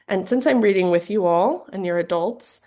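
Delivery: ordinary delivery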